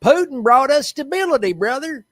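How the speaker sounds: high, loud mock Southern-Russian accent; hammed-up Russian patriot with Southern twang. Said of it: "Southern and Russian are very easily".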